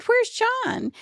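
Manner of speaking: surprised question. Retold or imitated. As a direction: pop the question up high in surprise. Mom retells asking son's whereabouts.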